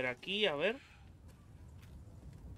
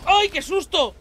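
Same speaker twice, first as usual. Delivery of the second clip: much higher, much louder fright; startled scream; 15 dB louder, slower than usual. Real, not acted. Player caught off guard by an enemy, startled scream. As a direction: jump and shriek in fright.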